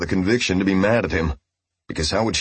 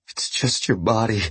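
whimpering; 4 semitones higher than usual. Acted. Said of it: "Roy whimpered to himself".

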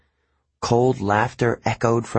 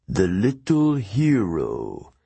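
amused ancient voice; much slower than usual. A narrator's reading. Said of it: "an amused voice echoed far down".

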